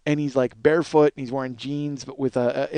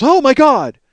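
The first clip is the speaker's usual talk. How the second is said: high, loud retold shriek of alarm; alarmed cry; 10 semitones higher, 10 dB louder, slower than usual. Wife on the phone startled by banging, alarmed. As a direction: act out a startled, panicky exclamation.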